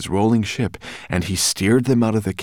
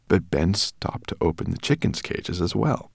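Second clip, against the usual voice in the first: usual speaking voice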